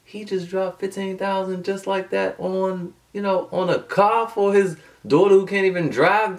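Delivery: inner disbelief. Retold or imitated. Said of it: "I was thinking to myself".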